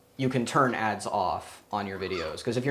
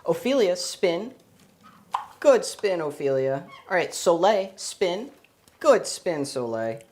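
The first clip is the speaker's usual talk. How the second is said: praising a pet; 7 semitones higher, 4 dB louder, slower than usual. Real, not acted. Cueing and praising parrot tricks.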